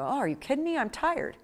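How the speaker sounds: high, scoffing self-put-down; dismissive brush-off. Retold or imitated. Someone told they look nice, mock dismissive. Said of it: "you go".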